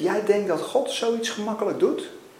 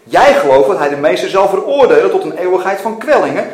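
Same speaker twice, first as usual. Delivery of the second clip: loud, emphatic confrontation; forceful challenge; 3 semitones higher, 11 dB louder than usual. A narrator's reading. God confronting Mackenzie about judgment, loud forceful challenge. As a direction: press the point hard, punching key words.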